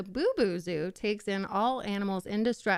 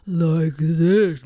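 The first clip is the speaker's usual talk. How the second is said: loud husky growl, low and rough; gravelly low voice; low gravelly dog voice; 3 semitones lower, 10 dB louder than usual. Shown as a demonstration. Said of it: "Low, like what?".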